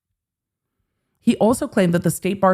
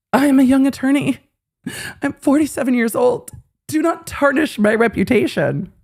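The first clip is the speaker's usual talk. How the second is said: higher, whiny quote voice; mocking whine; 7 semitones higher than usual. Mocking Ron pleading to the judge, whiny. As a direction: read it as a pitiful plea.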